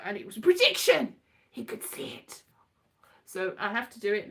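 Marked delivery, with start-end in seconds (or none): 1.5-2.4 whispered mysteriously; mock mystical whisper; spooky whisper. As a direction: hush it like a seer's secret. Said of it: "mock spooky aside".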